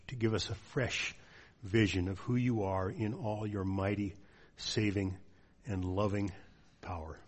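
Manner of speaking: hushed pastoral prayer. Real, not acted. Leads closing prayer.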